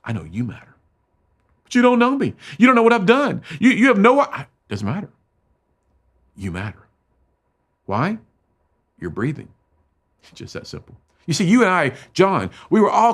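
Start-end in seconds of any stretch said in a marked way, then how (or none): 1.6-4.5 high, loud, anguished pleading; cracking high cry of despair; desperate outburst. Retold or imitated. John's desperate outburst, retold. 10.2-11.0 whispered. Acted. Quiet punchline after reassurance.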